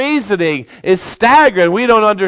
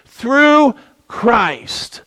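"through Christ" set apart, higher and drawn out, falling on Christ; stress on through Christ; 5 semitones higher than usual. Shown as a demonstration. Preacher telling the church to get these words, "through Christ" stressed high and slow. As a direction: lean hard on "through Christ" so the church catches it. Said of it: "get these words".

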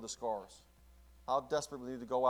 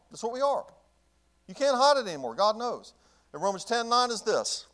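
higher, louder preacher's cry; fiery preaching; 7 semitones higher, 9 dB louder than usual. Acted. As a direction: preach it at full force. Preaching at full force.

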